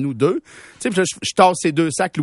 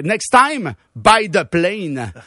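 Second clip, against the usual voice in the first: slow cutting retort; much slower than usual. Retold or imitated. Retorting to the seatmate.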